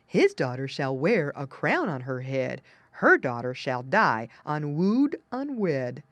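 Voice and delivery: lightly voiced dog prophecy; barking doggy. A narrator's reading. Doggy defying the stepmother, lightly barked chant. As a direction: chant the rhyme with a hint of bark. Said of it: "and barked, saying".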